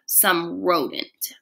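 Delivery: "rodent" drawn out slowly with disdain; disdainful rodent. A narrator's reading. Teacher sneering at the class hamster, slow disdain. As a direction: stretch "rodent" with contempt. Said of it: "Nobody says rodent quite the way".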